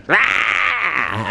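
long, high mock roar; angry yell. Furious catatonic patient leaps up at the doctors, high acted yell. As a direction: roar like an enraged man waking up. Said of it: "he was pretty pissed off".

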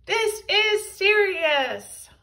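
high, loud, slow yell; villain's yell. A narrator's reading. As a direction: bellow it at the heroes. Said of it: "yelled Big Tippy".

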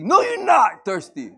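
loud, slow stern scold; mock scolding parent. Parent scolds thirsty kid, mock stern and loud. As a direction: shut the kid down.

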